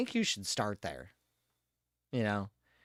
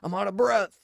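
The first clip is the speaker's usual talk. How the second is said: higher, louder whiny complaint; mock winded character; 8 semitones higher, 7 dB louder than usual. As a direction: whine that you're already out of breath. Voicing game character.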